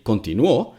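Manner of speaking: "continuó" stressed on the last syllable; stress on final Ó. Shown as a demonstration. Showing the past tense, final stress on "continuó". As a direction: land hard on the final ó of "continuó". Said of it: "changing the stress in a word".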